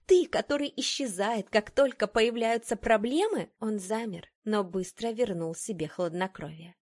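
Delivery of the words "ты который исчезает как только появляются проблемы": scornful, higher-pitched rhetorical questions; mocking reproach, pitch lifted; openly sarcastic. A narrator's reading. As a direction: pile up the accusations with open sarcasm. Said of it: "не скрывая сарказма".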